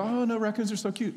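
high-pitched, gushing imitation of animal lovers; mock cooing objection. Voices people fond of raccoons.

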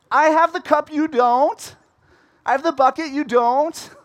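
high, loud sing-song taunt; taunting dig. Retold or imitated. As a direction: rub it in, playground style. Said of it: "she does a little dig at him".

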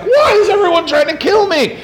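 high, laughing mock panic. Pastor voicing David on the run, high laughing mock panic. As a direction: whine it like a hunted man. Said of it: "and he's like".

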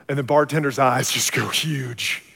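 ordinary delivery

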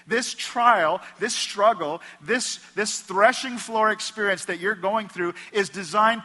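booming pulpit build; full-force preaching. Acted. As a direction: preach it hard and rising.

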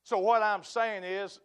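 ordinary talk, nothing marked

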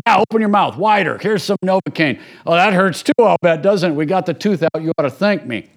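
lightly voiced no-nonsense dentist, brushing off the pain; blunt dentist. Blunt dentist telling the patient to be grateful, brusque. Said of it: "the guy who doesn't have the gift of encouragement".

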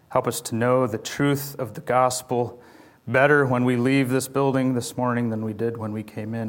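hushed, soft pastoral prayer. Acted. Pastor leading his church in a hushed prayer. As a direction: pray low and gently over the congregation. Opening prayer before the sermon.

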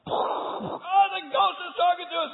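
high, loud scared cry; frightened cry. Acted. Disciples in the boat cry out, scared. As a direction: cry out in alarm.

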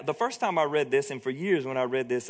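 ordinary delivery

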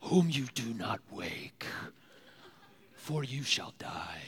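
slow whispered doom voice; a stranger's slow whispered threat from the dark bed; ominous whisper. Retold or imitated. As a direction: breathe a slow, menacing prophecy.

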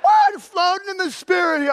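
high squeal then loud giddy drift; mock spaced-out believer. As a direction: play it hammed-up and dreamy. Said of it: "I'm not saying that".

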